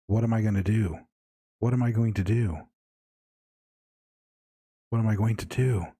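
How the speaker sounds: put-on young artist's voice repeating itself in fear, understated; mild panic. Young man echoing in Henry's head, mildly panicked. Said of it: "in your head in a panic".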